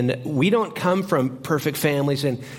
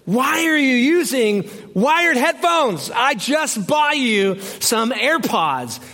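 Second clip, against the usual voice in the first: hammed-up disbelief, dragging the words; mock-horrified husband yelling; exasperated shout; 9 semitones higher, slower than usual. Husband horrified at wired headphones.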